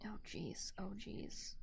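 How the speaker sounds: normal talking voice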